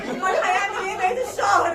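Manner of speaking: normal talking voice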